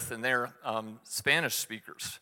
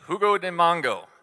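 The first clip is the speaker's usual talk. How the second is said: louder and higher, insisting; louder repeat; 5 semitones higher, 6 dB louder than usual. Retold or imitated. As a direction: say it again louder so she gets it. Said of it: "if you say it louder".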